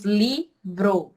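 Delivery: "livro" broken slowly into two syllables; slow, teacherly syllable split of "livro". Shown as a demonstration. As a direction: say "livro" in two separate pieces, pause between. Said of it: "Separando, ficam duas sílabas".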